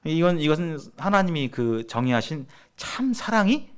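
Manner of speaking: usual speaking voice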